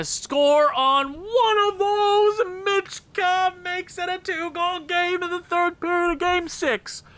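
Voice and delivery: high, loud drawn-out call; triumphant goal call. Real, not acted. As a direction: announce it like a broadcaster after the goal.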